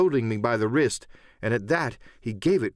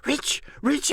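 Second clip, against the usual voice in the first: much higher, insistent repetition; heated insistence. A narrator's reading. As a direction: insist hotly, up high. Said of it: "repeated the statement hotly".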